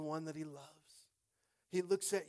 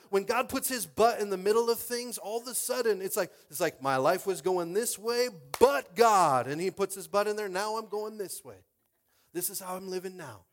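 loud, driving preacher voice, stretching the quoted lines; preaching at full force; 5 semitones higher, 10 dB louder than usual. Acted. Preacher firing up the congregation about God, loud and driving. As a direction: hammer the point home to the congregation.

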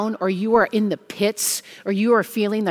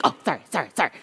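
sheepish mock apology; 4 semitones lower, 4 dB quieter, much faster than usual. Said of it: "And he's like".

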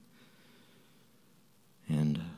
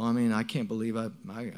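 ordinary talk

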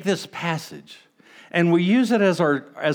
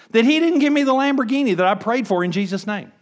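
ordinary talk

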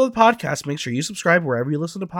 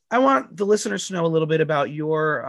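ordinary talk

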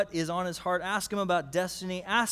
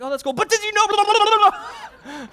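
mock a kid steamrolling into more trivia; excited son; 10 dB louder than usual. Son voiced by dad, laughing.

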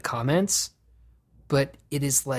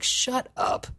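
scornful; 12 semitones higher, slower than usual. Real, not acted. Mocking performative statements.

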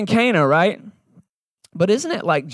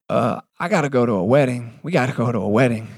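calm, low deflate; 5 semitones lower than usual. Retold or imitated. Jesus calmly deflating their hype.

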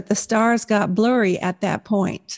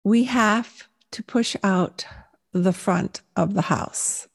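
exaggeratedly slow, with long gaps between phrases; slow-spoken husband; slower than usual. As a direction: imitate a man who is slow to speak. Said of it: "that's how slow he said it".

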